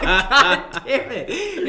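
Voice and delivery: slow, loud curse through laughter; laughing curse. Retold or imitated. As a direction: groan it out while laughing. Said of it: "And I'm like".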